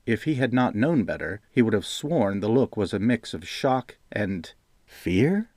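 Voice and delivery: plain reading voice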